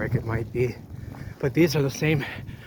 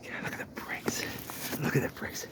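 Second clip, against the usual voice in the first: almost silent, leaning in to look; hushed whisper; 8 dB quieter than usual. Real, not acted. Explorer pointing the camera at old bricks, hushed whisper.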